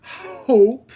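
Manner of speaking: a loud, strongly breathed h on hope; aspirated h. Shown as a demonstration. French streamer forcing the English h in hope. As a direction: push air hard into the h of hope. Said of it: "I have to stop to put a".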